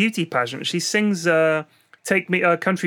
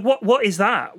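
ordinary talk, nothing marked

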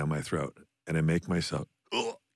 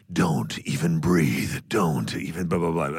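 slow, dramatic trailer announcer; movie trailer voice; 3 semitones lower, slower than usual. Retold or imitated. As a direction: drag each word out like a trailer. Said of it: "record the trailer voice".

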